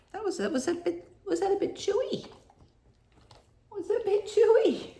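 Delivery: high, soft doting pet voice. Real, not acted. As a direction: coo to the dog in a high voice. Baby-talking the dog.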